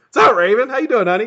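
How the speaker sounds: greeting a viewer, voice leaping up; voice jumping up. Real, not acted. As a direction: let your voice jump up on the hello. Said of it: "Did you hear my voice do that?".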